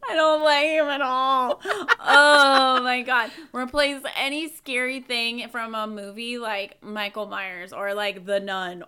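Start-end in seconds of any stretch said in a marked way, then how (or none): 0.1-3.3 loud whining half laugh; laughing whine. Real, not acted. Laughing, whining about Thomas.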